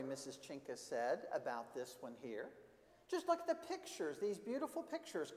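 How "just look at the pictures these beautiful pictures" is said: very high-pitched admiring voice; admiring librarian character. Acted. Missus Chinka shows Carlo the pictures, high admiring voice.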